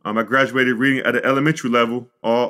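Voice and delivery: normal talking voice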